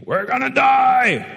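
high, loud, drawn-out mock scream of terror; panicked scream. Preacher acts out the terrified fishermen in the storm, screaming. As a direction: wail it like a fisherman sure he'll drown. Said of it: "are screaming".

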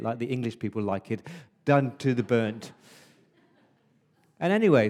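usual speaking voice

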